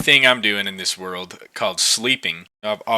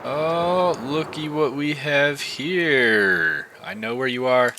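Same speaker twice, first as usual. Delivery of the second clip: sly, teasing; 4 semitones higher, slower than usual. Acted. Spots hidden mobs, sly tone.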